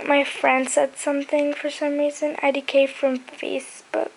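ordinary talk

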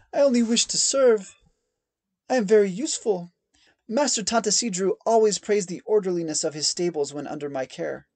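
high, loud, desperate voice; a stable hand pleading for his life, high and loud; frightened begging. A narrator's reading. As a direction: beg loudly to be spared. Terrified man pleads.

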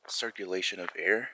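ordinary delivery

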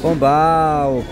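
ordinary talk, nothing marked

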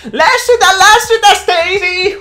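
very high, loud squeaky heckling; nerdy heckle. Retold or imitated. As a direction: squeal it like a nerd in the crowd. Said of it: "huutaa siellä yleisössä".

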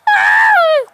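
high loud scream; piercing loud scream. Acted. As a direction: shriek as the platform moves.

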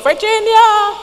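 high, loud call out the window; village-girl shout. Retold or imitated. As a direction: call her name across the yard, high and loud. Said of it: "like a good old village girl and shout".

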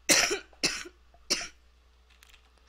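loud, harsh sick cough; hacking chesty cough; coughing fit. Real, not acted. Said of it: "'cause of my pneumonia".

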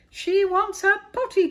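very high, loud call; cried out. A narrator's reading. Cook passing the call along, loud high cry. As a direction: yell the news down the line. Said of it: "cried the cook".

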